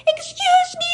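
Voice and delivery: very high, loud holler; calling out. Retold or imitated. Driver hollering from her window at distant officer, indignant call. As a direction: yell across to someone far away. Calls to officer parked far away.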